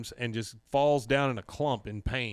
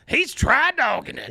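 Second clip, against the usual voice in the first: loud, high put-on holler; 11 semitones higher, 9 dB louder than usual.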